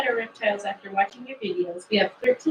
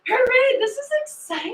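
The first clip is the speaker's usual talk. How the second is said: much higher, bright cheer; excited cheer; 14 semitones higher, 5 dB louder than usual. Real, not acted. Cohost celebrating bookings with fans, high excited cheer. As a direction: cheer like the news just landed.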